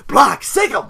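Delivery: loud, high dog handler's order; urgent mock command; attack command. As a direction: call it out like setting a dog loose.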